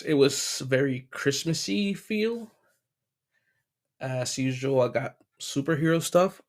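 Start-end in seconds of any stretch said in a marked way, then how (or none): none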